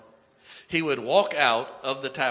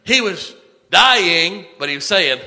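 preaching holler; 6 semitones higher, 12 dB louder than usual. Real, not acted. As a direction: belt out the opening, then settle. Preacher hollering after the climactic quote.

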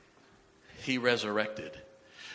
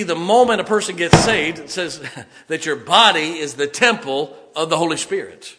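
loud, high, emphatic sermon voice; full-force preaching; 6 semitones higher, 11 dB louder than usual. Real, not acted. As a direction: holler each point out to the congregation.

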